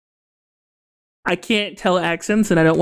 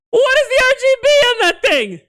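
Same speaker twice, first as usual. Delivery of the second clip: much higher, loud startled squeal; shrieking disbelief; 4 dB louder than usual. Real, not acted. Streamer shrieks at a bizarre object in the game, shocked. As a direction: shriek at the weird thing on screen.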